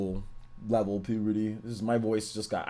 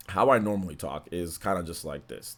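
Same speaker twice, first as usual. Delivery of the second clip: ordinary talk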